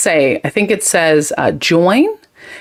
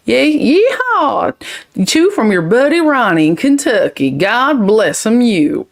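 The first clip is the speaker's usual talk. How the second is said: put-on Southern accent; 4 semitones higher than usual. Streamer reading Ronnie's Kentucky comment, exaggerated Southern accent. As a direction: put on a hammy Texas-Kentucky twang. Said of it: "Is that a Texas accent?".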